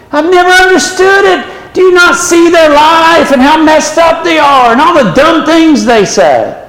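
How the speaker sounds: exasperated preaching. Real, not acted. Preacher exasperated about idolizing politicians.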